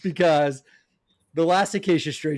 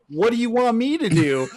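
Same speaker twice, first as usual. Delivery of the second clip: mild mock scream, pitched higher; retold scream; 6 semitones higher than usual. Retelling a singer on stage answering the crowd, mock scream. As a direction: imitate an exasperated shout without fully yelling. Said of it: "[name]'s up there just screaming".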